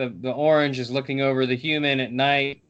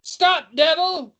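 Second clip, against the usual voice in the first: high-pitched guard's shout; acted shout, high and sharp; shouted command; 11 semitones higher, 4 dB louder, slower than usual. A narrator's reading. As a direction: call out urgently from afar. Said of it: "comes a shout from above".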